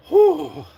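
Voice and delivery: high, loud relieved whew. Real, not acted. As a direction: blow out a big whew of relief. Relief after a scary moment.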